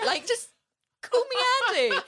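exasperated plea. Retold or imitated.

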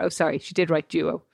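ordinary delivery

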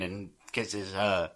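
ordinary talk

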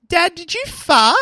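put-on high, slow child's question; squeaky little kid voice; little girl voice.

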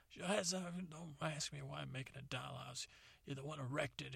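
high soft father voice; hurt father. Retold or imitated. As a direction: sulk as the wronged father. Father answers son, aggrieved.

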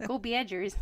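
mock Midwest sports-fan accent; put-on Midwest twang; Midwestern accent. Shown as a demonstration. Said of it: "that's their accent".

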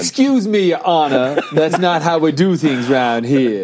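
slow Southern car salesman voice, laughing; Southern accent. Retold or imitated. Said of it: "deep southern kind of car salesman".

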